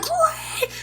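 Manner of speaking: very high yelled "Green team!"; high pitched retold scream; screamed team call. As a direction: call out the team name while running across the map. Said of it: "'Cause I ran and screamed".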